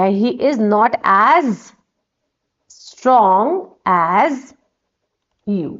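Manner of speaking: stretched out, word by word; very slow dictation. Shown as a demonstration. Teacher dictating the answer to students, very slow. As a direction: lay each word down slowly for them to copy. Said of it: "यहाँ पे not आ जाएगा".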